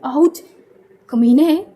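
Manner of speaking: ouch then a loud insult; slapped on the back, yelps; yelp of pain. A narrator's reading. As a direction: cry out from a sudden slap. Slapped on the shoulder.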